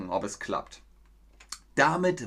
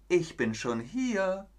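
higher, slow drawn call; smug call; 7 semitones higher, slower than usual. Acted. Hedgehog greets the hare again, higher and slow. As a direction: drag it out smugly.

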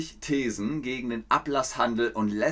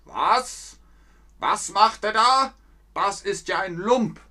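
higher, louder, indignant pope; outraged pope; 7 semitones higher, 3 dB louder than usual. Retold or imitated. Teacher voicing the pope reacting to Luther, outraged. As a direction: play the shocked, angry pope. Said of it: "Der Papst hört das und sagt".

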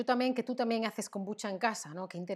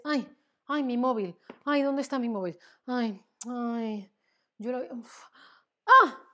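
panicked about lost phone; 5 dB louder than usual. Acted. Acts out losing the phone.

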